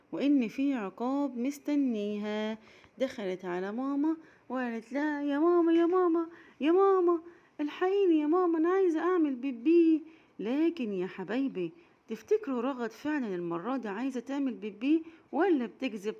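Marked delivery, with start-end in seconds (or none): 5.2-10.0 put-on high, louder child calling out; urgent cries to wake mother; calling mama. Raghad calling her mother again, loud high child voice.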